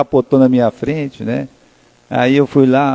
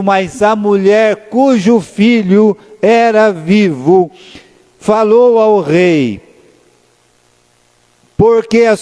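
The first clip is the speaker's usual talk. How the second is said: slow, higher, measured Bible reading; preacher reading scripture to the congregation; solemn scripture reading; 4 semitones higher, slower than usual. Acted. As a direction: lift the verse out slowly, word by word. Reads Bible verse aloud.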